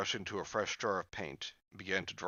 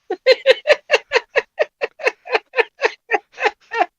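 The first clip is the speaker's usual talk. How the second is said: high laugh; 18 dB louder than usual. Real, not acted. Laughing at own bit.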